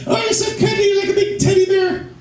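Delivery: high, sugary cooing voice; mock girly coo; cooing women. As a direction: coo it like talking to a puppy. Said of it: "They're like".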